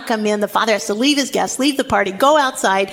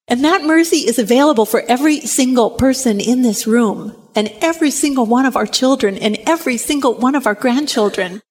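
normal talking voice

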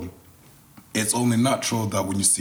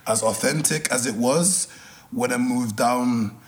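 a light, faded Scottish accent; 3 semitones higher than usual. Shown as a demonstration. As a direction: put on your old Scottish accent for the host. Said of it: "can you do your Scottish accent for us?".

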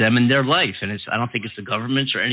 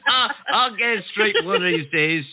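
laughing; 6 semitones higher than usual. Real, not acted. Laughing off his slip.

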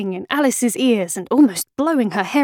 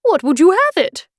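high, louder retort; royal challenge; 8 semitones higher, 5 dB louder than usual. A narrator's reading. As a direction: challenge the girl as a queen. Queen challenges Alice.

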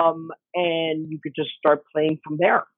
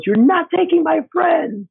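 heroic threat; 11 semitones higher, 4 dB louder, slower than usual. Retold or imitated. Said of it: "She turns around and she goes".

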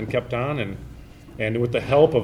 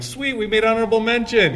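high excited outburst; pleased squeal, pitched way up; 11 semitones higher, 4 dB louder than usual. Retold or imitated. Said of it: "I'm like".

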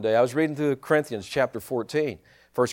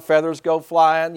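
ordinary talk

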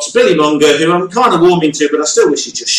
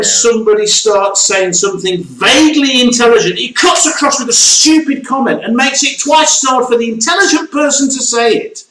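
high-pitched, worked-up complaining; exasperated rant; 7 semitones higher than usual. Real, not acted. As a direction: rant in comic frustration, pitch held high. Exasperated rant about Jonesy interrupting.

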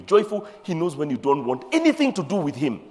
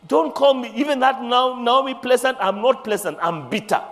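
bitter retort; 7 semitones higher, 3 dB louder than usual. Acted. Naomi's retort voiced by preacher.

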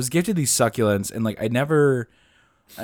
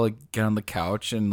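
ordinary delivery

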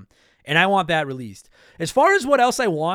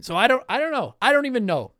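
ordinary delivery

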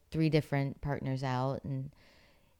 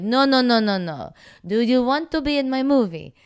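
higher, louder hammed-up Italian accent; Italian director accent; 10 semitones higher, 10 dB louder than usual. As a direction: insist like an Italian director correcting her. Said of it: "That's better".

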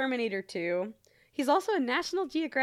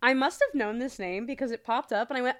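ordinary talk

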